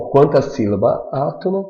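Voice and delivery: ordinary talk, nothing marked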